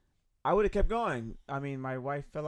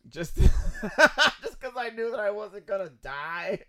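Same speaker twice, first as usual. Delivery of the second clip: laughing; 7 semitones higher, 7 dB louder, slower than usual. Real, not acted. Laughing at own confession.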